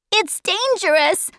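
put-on high child's voice; warning boy. Charles Wallace warning Meg and Calvin, high urgent boy. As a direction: warn them in a high boy's voice. Boy warns his companions.

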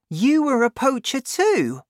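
high, loud astonished cry; can't believe the old doctor poached; cried out. A narrator's reading. Boy crying out to Doc Spencer in astonishment. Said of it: "I cried".

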